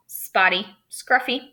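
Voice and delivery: ordinary talk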